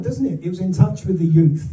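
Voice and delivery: ordinary talk, nothing marked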